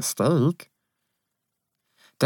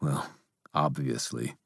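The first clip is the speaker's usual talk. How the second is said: dry, wry aside; 7 semitones lower, 11 dB quieter than usual. Acted. Harry dry remark.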